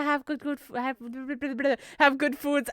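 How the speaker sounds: stumbling, laughing false starts; tongue-tied. Real, not acted. Been talking for too long, tongue-tied. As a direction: trip over the words and splutter into a laugh. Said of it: "I can't speak anymore".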